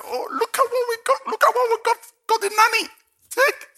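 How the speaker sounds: high, loud, gushing offer; hammed-up high excited voice; excited gift giver. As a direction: squeal it out like a thrilled giver. Pastor voicing himself handing gift.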